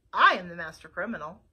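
sloth's reveal. Acted. Sloth confessing to Flytrap, low and quick. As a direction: drop the bombshell casually.

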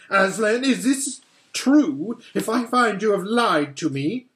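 menacing queen. Acted. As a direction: demand the truth with menace. Witch threatens Edmund.

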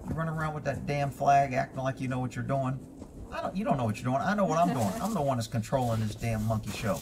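low, gruff talking horse. Acted. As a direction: speak as a cocky horse. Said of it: "This horse says".

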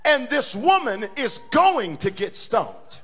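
loud, high climactic preaching shout; preaching at full force. Acted. Pastor preaching grace to congregation, loud climax. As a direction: build to a roaring sermon peak.

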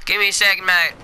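excited Australian accent on short bursts. Real, not acted. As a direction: talk like a hyped-up Aussie mate. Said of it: "like, an Australian accent".

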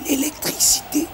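whispered gasp; breathed in awe. A narrator's reading. Killy realises what Reedus is doing, breathless whisper. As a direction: say it on a breath, stunned. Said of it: "dit Killy dans un souffle".

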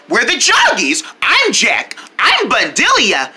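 ordinary delivery, nothing marked

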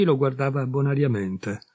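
ordinary delivery

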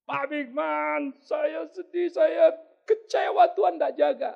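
high, slow, loud lament of a betrayed wife; distressed caller. Acted. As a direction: wail down the phone. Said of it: "Satu orang telepon saya". (istri yang dikhianati menelepon sambil meratap)

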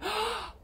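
surprised gasp. Pretend. Surprise at the full moon.

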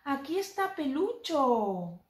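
lively picture-book reading; reading to a toddler. Acted. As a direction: read playfully to a small child.

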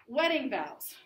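ordinary talk, nothing marked

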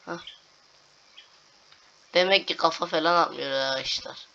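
ordinary delivery

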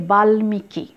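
বাল্মীকি as bal-mi-ki, full m; ম sounded. Shown as a demonstration. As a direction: say a clear m after the l in বাল্মীকি. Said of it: "ময়ের সাথে". (বাল্মীকি-তে স্পষ্ট ম উচ্চারণ)

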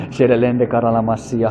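slow, hushed tongues; soft glossolalia, half whispered; speaking in tongues. Real, not acted. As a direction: murmur the syllables softly and slowly. Praying in tongues after laugh.

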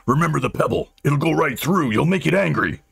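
restrained acted shout of alarm; shouted warning. A narrator's reading. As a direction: call out a warning to stop him.

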